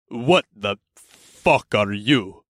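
slow gruff orc demand. A narrator's reading.

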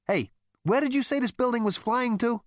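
loud, high-pitched demand. A narrator's reading. Zaphod confronts Rooster about where the building is flying, demanding. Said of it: "demanded Zaphod".

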